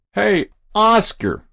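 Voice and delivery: high, drawn-out loud holler; fireman's yell. A narrator's reading. Winded fireman to the boy on the roof, yelling. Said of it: "the winded fireman yelled".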